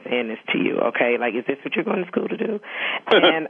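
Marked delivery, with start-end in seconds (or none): 1.4-2.6 higher, fast mock outraged father; dad going off. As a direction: rattle it off as an indignant parent. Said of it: "he, like, went off".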